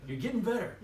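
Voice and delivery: retold coach's upbeat praise; encouraging coach. Coach cheering the trainee on.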